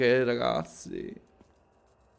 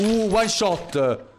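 louder, higher, drawn-out whoop; excited cheer; 6 semitones higher, 5 dB louder, much slower than usual. Real, not acted. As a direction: stretch it out in delight. Cheering a one-shot kill.

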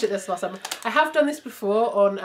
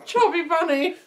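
laughing through a stuffed mouth; muffled giggly words; mouth full; 6 semitones higher, 4 dB louder than usual. Real, not acted.

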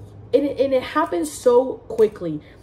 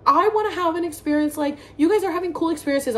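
higher, whiny wish; high, lightly whiny roomie; whiny roommate; 7 semitones higher than usual. Retold or imitated. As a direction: mimic a pouty roommate. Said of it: "One of my other roomies was like".

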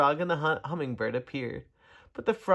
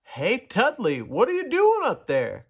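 high, raised call upward; calling from below; 7 semitones higher, 5 dB louder than usual. A narrator's reading. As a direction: call up to someone far above. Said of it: "called the voice from below".

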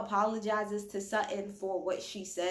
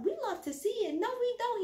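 much higher, sarcastic repeat then denial; mock Garcelle retort. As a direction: throw his words back at him. Garcelle mocking and rejecting it.

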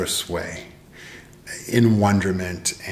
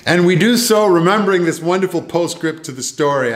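rousing emphasis; 7 semitones higher, 6 dB louder than usual. Real, not acted. Preacher lifting to the climax.

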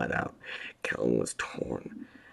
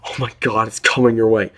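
terrified warning; 8 semitones higher, 12 dB louder than usual. A narrator's reading. Sobbing man warns in fear.

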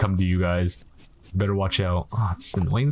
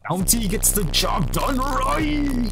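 much higher, slow announcer singsong; mock ad jingle; 13 semitones higher, much slower than usual. Shown as a demonstration. Gamer to viewers, hammed-up high commercial slogan.

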